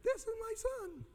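squeaky falsetto God; mock-divine line in tiny high pitch; real high voice. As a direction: put on a comically high voice. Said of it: "Maybe God's got a real high voice".

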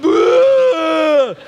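loud high hammed-up bawling wail; mock ugly sob. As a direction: fake a hideous howling cry. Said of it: "I'm a hideous crier".

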